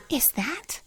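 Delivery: soft, breathy whisper; hushed question. Acted. Friend whispering to Butterfly, unsure what she sees. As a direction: whisper it, caught off guard. Startled at something on screen.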